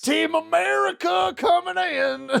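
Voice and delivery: high, drawn-out mock-heroic shout; over-the-top rallying cry on the verge of laughing; gung-ho hero. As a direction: holler it like a cartoon action hero. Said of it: "And Aragorn's like".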